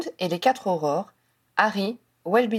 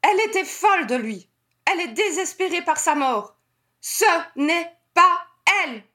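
high, desperate insistence; feverish, emphatic declaration in a high pitch; hammered home; 8 semitones higher than usual. A narrator's reading. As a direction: drive each statement home. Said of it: "il asséna".

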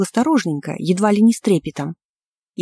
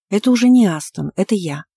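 usual speaking voice

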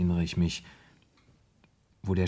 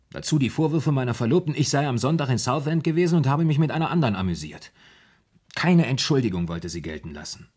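rushed, raised, louder telling of her accusations; worked-up retelling of the fiancée's reproaches; agitated; 5 semitones higher, 4 dB louder, faster than usual. Acted. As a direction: spill it out fast and indignant.

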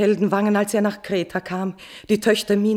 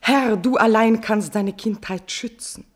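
ordinary talk

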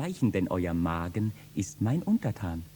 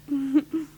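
high light laugh; high amused chuckle. Acted. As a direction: laugh at your own charm. Laugh after flirting.

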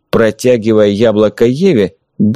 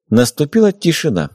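usual speaking voice

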